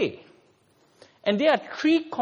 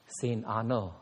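savoring title; 8 semitones lower, 9 dB quieter than usual. Pretend. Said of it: "Nice ring, huh?".

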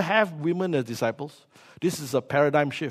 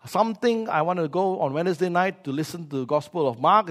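ordinary delivery

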